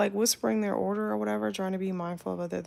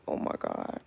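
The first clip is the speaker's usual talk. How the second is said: whispered exasperation. Retold or imitated. Exasperated aside in her head.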